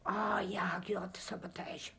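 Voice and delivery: whispered confession; secretive whispered admission. Real, not acted. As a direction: hush it like a secret. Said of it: "confessing sabotage in a whisper".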